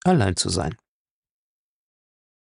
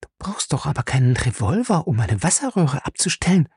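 alarmed; 4 semitones higher than usual. Acted. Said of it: "fragte sie erschrocken".

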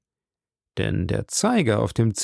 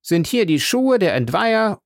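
much higher, presenting voice; court usher; 9 semitones higher than usual. A narrator's reading. Usher presenting evidence to the judge, high announcing voice. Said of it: "fügte der Gerichtsdiener bei".

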